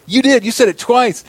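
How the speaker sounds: high, loud retold accusation; gleeful insistence. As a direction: imitate a coworker crowing over catching you out.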